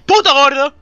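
loud, punchy mock-angry jab; angry insult. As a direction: blurt it out loud like an annoyed player. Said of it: "cuando estoy cabreado y es el del equipo enemigo".

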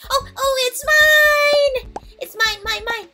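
high, loud possessive squeal; possessive whining. Real, not acted. Sees her own entry.

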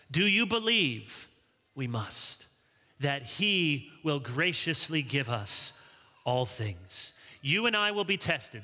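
ordinary talk

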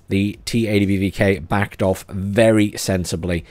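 usual speaking voice

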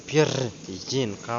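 very slow, separated syllables in "Пір'їнка". Shown as a demonstration. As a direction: stretch out each syllable of "Пір'їнка". Splitting a word into syllables. (повільно, по складах)

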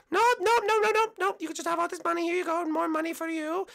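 insistent high falsetto; pleading cyclist contestant. Acted. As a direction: push the offer in a girlish falsetto.

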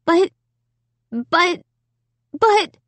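high, halting, rising protest; stammered protest. A narrator's reading. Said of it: "raised a hand to silence me".

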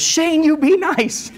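high, slow, laughing mock mom; mom's warning. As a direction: playfully scold like a mother. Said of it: "My mom says".

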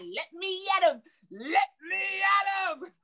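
a mock shout, slowed down; hammed-up eager cry; mock eager friend. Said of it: "She's like".

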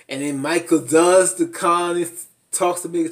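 normal talking voice

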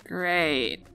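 ordinary delivery, nothing marked

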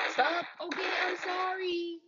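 high frantic shout of apology; panicked yell. Real, not acted. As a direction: yell it out in alarm. Yelling at game players.

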